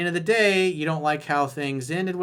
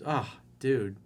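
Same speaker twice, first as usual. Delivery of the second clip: usual speaking voice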